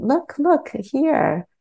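lightly voiced guide drawing attention to a sight; tour guide pointing. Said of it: "imagine I'm a tour guide".

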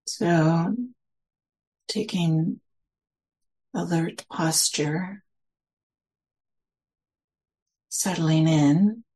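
slow, spacious meditation guidance with long pauses; guided meditation. Real, not acted. Teacher settling listeners into their senses, slow guided meditation. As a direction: lead them gently inward, phrase by unhurried phrase.

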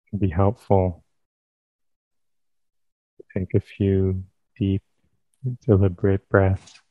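calm, unhurried phrases with long pauses; guided meditation. Real, not acted. Teacher leading listeners into sitting meditation, calm guided meditation. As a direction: guide them gently into stillness, leaving space between phrases.

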